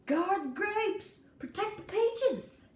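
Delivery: slow, loud, high howled orders; rally everyone to save the fruit; frantic howl; howling command. A narrator's reading. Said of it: "Daddy howled".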